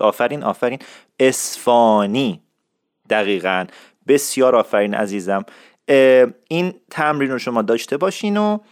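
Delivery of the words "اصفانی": "اصفهانی" very slowly as es-faaa-ni, no h; slow syllables in "اصفهانی", long open middle vowel; correct pronunciation model. Shown as a demonstration. Teacher praising learners and repeating, very slow h-less "اصفهانی". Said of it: "بهتر شد".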